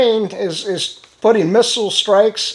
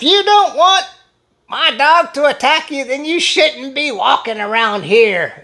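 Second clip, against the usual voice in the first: high, snippy imitation of the woman; mock shouting woman; 5 semitones higher than usual. Dog owner snapping back at the hiker, mock high voice. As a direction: play her as snotty and high. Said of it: "she shouted to me".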